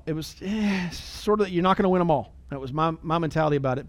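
normal talking voice

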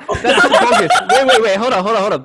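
high, loud laughing objection; laughing protest. Real, not acted. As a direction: laugh while cutting in.

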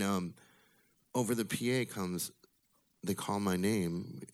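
normal talking voice

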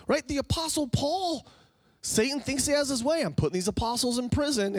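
high-pitched, driving sermon delivery; rising, fervent pulpit proclamation; impassioned preaching. Real, not acted. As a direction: preach it at full force, pitch climbing high. Preaches at full force.

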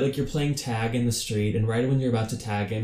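usual speaking voice

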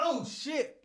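sudden high yelp mid-cough; startled oh shit. Real, not acted. Choking on spit mid-podcast, startled high yelp. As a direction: caught off guard by a cough, yelps high.

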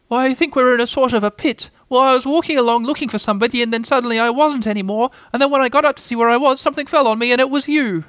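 small high voice, hurrying along; high-voiced, flustered Piglet. A narrator's reading. Piglet explaining the pit to Pooh, flustered. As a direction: tumble through the tale up high. Piglet tells what happened.

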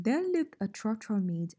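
ordinary talk, nothing marked

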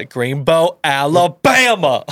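slow, loud Southern drawl; Forrest Gump. Acted. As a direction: stretch it out in a big Southern accent. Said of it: "a lot like Forrest Gump".

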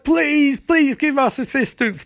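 high, pleading cry for help; mock desperate plea. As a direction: beg for help in a hammed-up panic.

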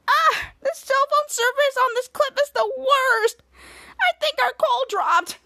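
high, slow, drawn-out complaint; put-on high voice, stretching the words; whining caller. [name] on a bad phone line, high slow whine.

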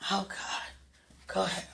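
ordinary talk, nothing marked

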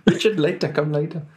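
put-on high, loud South African accent. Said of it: "South African accent".

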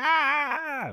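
imitated very high child's scream; dying-kid scream. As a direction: mimic a kid wailing as if dying. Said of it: "like he's dying or something".

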